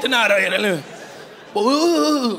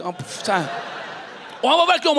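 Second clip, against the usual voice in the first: normal talking voice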